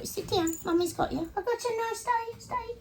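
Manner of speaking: very high, soft doggy-mummy voice; soothing pet talk. Real, not acted. Owner settling her confused dog, soothing high pet talk. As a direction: reassure the dog in a high little voice.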